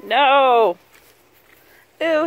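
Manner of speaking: usual speaking voice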